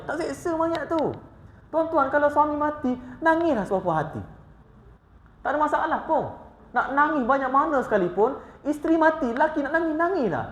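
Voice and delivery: high, driving preacher's voice; fervent preaching. Acted. Preacher urging the congregation to weep freely, fervent. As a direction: preach it out to the hall.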